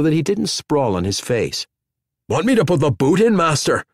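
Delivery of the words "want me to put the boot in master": quick, eager and a bit louder; hopeful eager servant. A narrator's reading. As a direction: offer it keenly, hoping for a yes. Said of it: "Hogg said hopefully".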